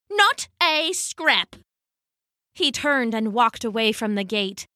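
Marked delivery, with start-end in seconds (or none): 0.1-1.6 NOT A SCRAP drawn out, high; haughty echo. Acted. Haddo refusing the hungry crowd bread, slow haughty echo. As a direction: throw it back slowly, pitched up, each word separate. Haddo throws their words back.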